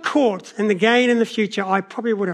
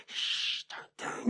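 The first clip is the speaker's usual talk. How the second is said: urgent whispered hush to a little brother; whispering so the uncle won't hear; 17 dB quieter, slower than usual. Retold or imitated. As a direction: keep it secret, barely a breath. Sneaking play, hushing little brother.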